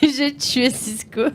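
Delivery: cracking up through the numbers; laughing. Real, not acted. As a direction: laugh while reading it out. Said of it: "le rire fabuleux de Cisco".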